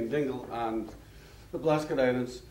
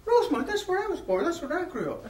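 very high and louder, thrilled; overjoyed Irishman; 3 dB louder than usual. Retold or imitated. Irishman discovering a shared home town, very high and thrilled. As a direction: shout out the shared birthplace. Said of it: "getting more and more excited".

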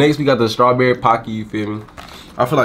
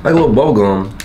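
snacking between words; talking while chewing snack. Real, not acted. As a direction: stick held at lips. Seen 1: left speaker nibbles pink stick at lips while talking.

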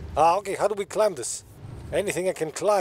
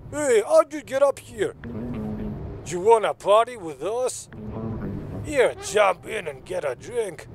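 shaky attempt at an Italian voice; Italian accent; 3 semitones higher than usual. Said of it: "I could voice this Italian".

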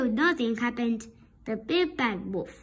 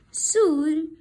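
ordinary delivery

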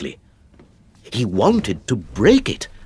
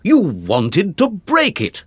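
accusing; 8 semitones higher, 4 dB louder than usual. Acted. Father accuses his son.

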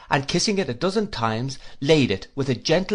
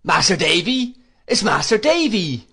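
higher, louder cry of surprised recognition; excited shout; 7 semitones higher, 6 dB louder than usual. A narrator's reading. Ham spots Master Davy coming in, excited shout. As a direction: call it out loudly, pitched up. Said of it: "when Ham shouted".

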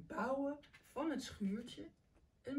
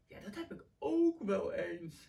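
words stretched through a yawn; lightly yawned, drowsy octopus; 3 semitones lower, 4 dB louder than usual. A narrator's reading. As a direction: yawn it out, sleepy. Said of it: "graapt Octopus".

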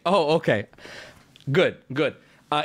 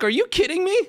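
high disbelieving yelp; incredulous outrage; 11 semitones higher, faster than usual. Retold or imitated. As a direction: fling it at them, appalled. Outraged at kids.

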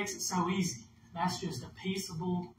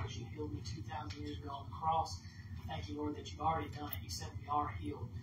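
soft, low prayer sinking to a whisper; quiet healing prayer; 3 semitones lower, 10 dB quieter than usual. Real, not acted. Pastor laying hands on Miss Brenda, soft hushed prayer. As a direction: pray softly over her, hushed and intimate.

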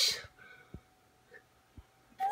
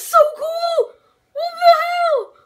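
thrilled yelling; 9 semitones higher than usual. Real, not acted. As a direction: scream with joy at the reveal. Fan reacts to a reveal.

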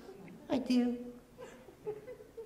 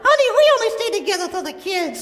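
squeaky ventriloquist dummy; 9 semitones higher, much faster than usual. Acted. Dummy wife wisecrack.

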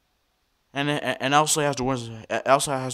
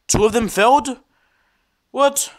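real angry yelling, louder and higher; boiling over at the crashed program; angry outburst; 4 semitones higher, 5 dB louder than usual. Real, not acted. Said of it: "I'm sorry for being mad and angry".

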